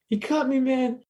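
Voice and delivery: high, strained-up imitation of a victim; retold high yell; mock screaming. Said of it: "he starts screaming".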